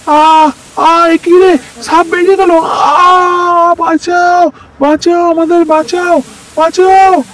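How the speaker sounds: high screaming cries for help; terrified screams. Acted. Brothers scream for help as the snake comes out, terrified shrieks. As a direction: shriek for rescue as the snake strikes.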